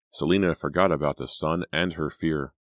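usual speaking voice

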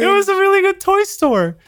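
very high mock childlike whine; mock wistful. Missing his childhood toy store, high childish whine. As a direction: whine it like a sad kid.

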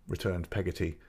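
usual speaking voice

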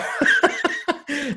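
a burst of high-pitched real laughter. Real, not acted. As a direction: crack up at the punchline. Still laughing at the punchline.

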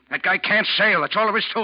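loud, high furious insistence; angry outburst. Acted. First mate angrily refusing to let the new second mate sail. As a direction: slam the door on the idea.

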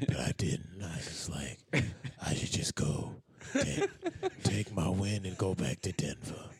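lowest voice. Pretend. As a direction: drop to the bottom of your range. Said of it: "lowest voice".

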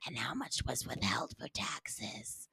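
breathy, high mock-seductive whisper; mock sexy IRS whisper. IRS agent teasing Natalie, mock seductive whisper. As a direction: whisper it like a creepy tax agent. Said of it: "the I R S will be taking over".

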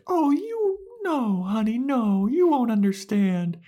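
very high, sugary talking-down voice; mock patronizing Anya. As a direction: coo at her like a small child.

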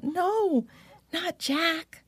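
high, dismayed cry of protest. A narrator's reading. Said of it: "Lisa Ann cried out".

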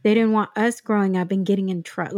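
usual speaking voice

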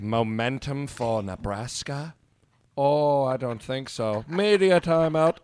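slow, low, smug dismissal; scheming network boss voice; smug TV executive. Pretend. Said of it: "is like".

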